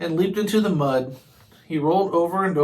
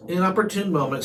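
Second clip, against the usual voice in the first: ordinary delivery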